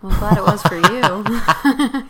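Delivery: laughing sarcastic quip. Real, not acted. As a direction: tease it through a laugh. Teasing reply while laughing.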